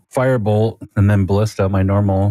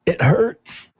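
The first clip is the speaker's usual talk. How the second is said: high, plaintive in-character warning; mock-pained, high little voice; hurt character; 9 semitones higher than usual. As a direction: play a wounded character, pitched well up. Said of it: "Ward will say, uh, to her before".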